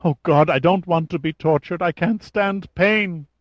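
high, panicky begging, slowing at the end; whimpering captive begging for mercy; childlike pleading. A narrator's reading. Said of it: "like the pleas of a child".

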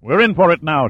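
loud, high shout under gunfire; shouted warning. A narrator's reading. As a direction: yell it over the shooting. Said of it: "Shaw shouted".

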